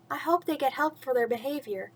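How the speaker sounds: usual speaking voice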